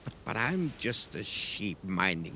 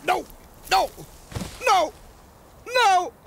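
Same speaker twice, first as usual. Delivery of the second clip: frantic loud yells of no, climbing higher each time; shouted no; 9 dB louder than usual. Acted. Sheep yelling no in panic, louder and higher. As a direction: scream no over and over, building. Repeated cries of refusal.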